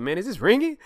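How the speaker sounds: high-pitched, incredulous question; surprised reaction. Retold or imitated. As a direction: voice shooting up in surprise. Retelling surprise phone gift.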